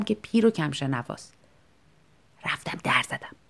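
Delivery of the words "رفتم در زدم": soft unvoiced whisper. Shown as a demonstration. Narrator knocking at night, whispering. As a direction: breathe the words, no voice. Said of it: "با نرمهٔ نوک انگشتم".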